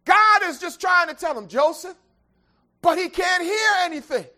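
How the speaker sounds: loud, high whooping sermon climb; preaching at full force. Real, not acted. Preacher whooping to the congregation, loud and high. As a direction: build the sermon to a roaring peak.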